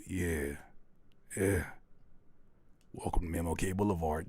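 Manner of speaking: Morgan Freeman impression. Mocking the producer's intro in a low Morgan Freeman voice. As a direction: imitate a low movie narrator. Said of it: "with your Morgan Freeman voice".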